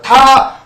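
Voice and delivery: 다 said high and loud with the tongue touching; 다 as the wrong version with contact; 다 with a hard tongue touch; wrong touching sound. Shown as a demonstration. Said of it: "닿게 되면 이런 소리가 나겠죠?".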